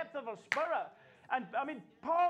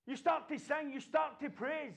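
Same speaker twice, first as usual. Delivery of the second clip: ordinary talk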